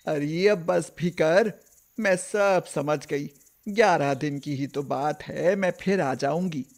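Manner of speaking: put-on old grandmother. Grandmother gives in.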